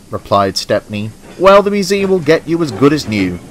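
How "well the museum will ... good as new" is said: higher-pitched storybook engine voice; cheering up a friend. A narrator's reading. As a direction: pitch it up, encouragingly. Stepney reassures his damaged friend.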